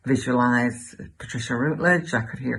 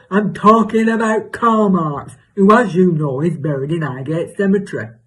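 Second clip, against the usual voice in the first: imitated exasperated neighbour; 4 semitones higher, 8 dB louder than usual. Neighbour correcting her about Karl Marx, loud mock exasperation. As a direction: mimic a neighbour spelling it out emphatically.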